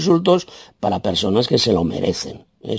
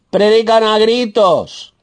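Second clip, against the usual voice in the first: high, drawn-out cry; emphatic raised voice; 11 semitones higher, 4 dB louder, much slower than usual. Shown as a demonstration. Preacher denouncing pastors who shout, emphatic raised voice. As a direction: stretch it out high and loud.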